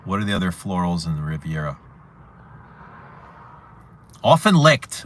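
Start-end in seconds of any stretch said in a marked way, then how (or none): 4.2-5.0 excited exclamation. Real, not acted. Reading out a perfume name.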